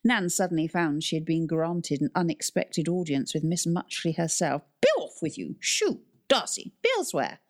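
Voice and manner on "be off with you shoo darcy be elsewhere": high, commanding shooing voice; booming warrior king. Acted. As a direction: drive the cats away imperiously. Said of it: "the librarian proclaimed".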